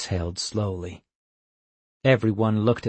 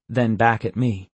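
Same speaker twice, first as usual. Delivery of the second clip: plain reading voice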